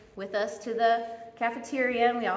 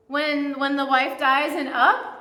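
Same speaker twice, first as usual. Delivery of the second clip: ordinary talk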